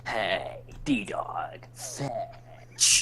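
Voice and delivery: whispered soldier growl; Snake voice. Retold or imitated. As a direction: hush it like Snake calling a dog. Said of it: "Say it again".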